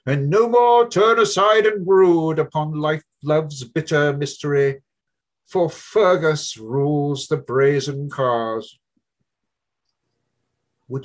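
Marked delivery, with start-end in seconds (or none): 0.1-8.8 booming drone. Acted. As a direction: boom the poem down the stairwell. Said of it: "the drone of his descending voice boomed".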